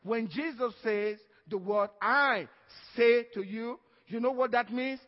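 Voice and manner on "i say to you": "I" and "say to you" both hit loud and full; emphatic "I say to you". Shown as a demonstration. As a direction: lean hard on "I say to you". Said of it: "this is what I emphasize".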